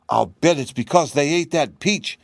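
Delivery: cheeky little kitten. A narrator's reading. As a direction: chirp it like a kitten. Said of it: "cried the kitten".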